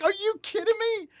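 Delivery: disbelief. Retold or imitated. Host retelling disbelief at buddy's salary, high. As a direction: squeal it in disbelief. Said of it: "I'm like".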